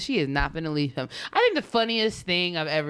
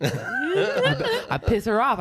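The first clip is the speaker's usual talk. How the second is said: laughing; 8 semitones higher, much slower than usual. Real, not acted. Laughing while retelling.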